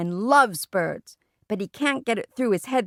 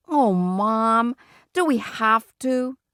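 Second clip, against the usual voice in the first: slow, raised whine; whining; 3 semitones higher, much slower than usual. A narrator's reading. Peter objecting to watching Fudge, whining. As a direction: drag the words out in protest.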